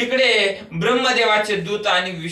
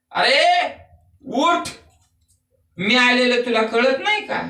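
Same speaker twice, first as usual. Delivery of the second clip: indignant Brahma; 7 semitones higher, 3 dB louder than usual. Acted. Brahma scolds sleeping Vishnu.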